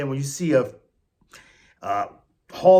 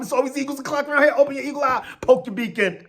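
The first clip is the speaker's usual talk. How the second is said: higher, fast hype-man delivery; hype sign-off; 8 semitones higher, faster than usual. Real, not acted. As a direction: pump up the Eagles fans. Hyping his sign-off.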